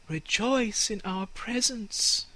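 high, slow, gentle airy voice; slow gentle sunlight voice; air and sunlight. Acted. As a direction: soothe the tree slowly.

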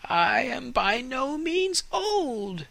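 high, slow, proud tree voice; proud fir tree. Acted. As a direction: speak as the vain young fir.

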